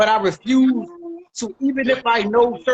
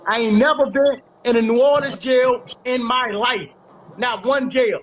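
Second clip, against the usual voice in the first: spaced out word by word for emphasis; slow it down and land each phrase hard; deliberate emphatic denial. Real, not acted. Speaker repeats the New Orleans jail denial, deliberate and emphatic.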